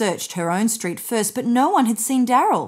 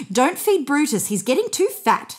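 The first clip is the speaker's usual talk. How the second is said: mock scolding; 7 semitones higher than usual. A narrator's reading. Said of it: "pretending to be angry".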